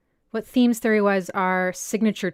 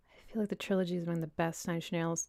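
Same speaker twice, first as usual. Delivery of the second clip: mumbling; 2 semitones lower, 9 dB quieter, faster than usual. Real, not acted. Streamer reading a viewer's comment on Nine Inch Nails, mumbling softly. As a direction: mumble it quietly, half to yourself. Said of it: "I'm mumbling".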